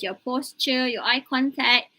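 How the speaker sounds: ordinary delivery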